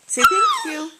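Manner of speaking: put-on very high, loud baby-prompt squeak; high squeaky voice.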